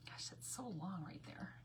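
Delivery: soft unvoiced whisper to herself; a hushed aside while working. Real, not acted. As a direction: breathe it out under her breath. Muttered aside while crafting.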